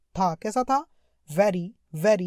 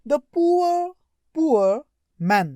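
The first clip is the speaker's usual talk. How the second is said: pitying emphasis; 6 semitones higher, 5 dB louder, much slower than usual. Shown as a demonstration. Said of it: "reading with feeling".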